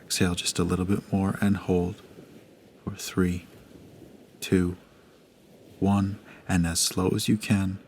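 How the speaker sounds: hushed, unhurried breathing guide; guided meditation. Acted. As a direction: gently guide the listener through each breath.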